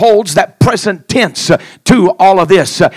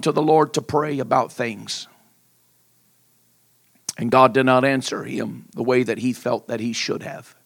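hushed sermon; 10 semitones lower, 10 dB quieter than usual. Acted. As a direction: draw the church in quietly. Soft, hushed intimate preaching.

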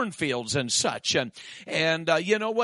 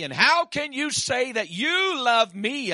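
higher, slower, louder wounded plea; pleading Delilah; 7 semitones higher, 4 dB louder, slower than usual. Acted. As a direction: Delilah nags Samson for his secret, pleading high. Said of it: "here goes Delilah again back to him".

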